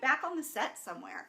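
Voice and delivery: ordinary delivery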